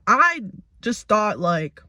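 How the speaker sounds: normal talking voice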